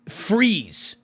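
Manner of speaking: ordinary talk, nothing marked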